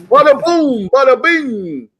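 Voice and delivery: high, loud Italian wiseguy; hammed-up Italian-American accent. Joker answers talk about accents, mock Italian-American accent. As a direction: punch it out as a cartoon Italian mobster. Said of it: "Talk about their accents".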